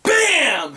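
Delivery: high, drawn-out "bam!"; imitated crash. Skateboarder retelling his garage door crash, exclaimed impact. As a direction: punch out the impact like a sound effect.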